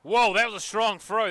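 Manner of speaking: loud, lifted exclamation; impressed surprise. Real, not acted. Commentator reacts to a strong throw, impressed. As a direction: react with a big, high "whoa".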